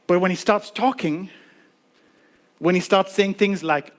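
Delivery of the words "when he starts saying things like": ordinary talk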